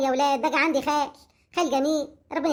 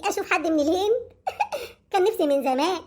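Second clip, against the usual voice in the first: giggling through the words; laughing; 4 semitones higher than usual. Acted. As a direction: laugh while teasing the guest. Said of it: "أنت يا أختي بتضحكي على إيه؟". (ضحك أثناء الكلام)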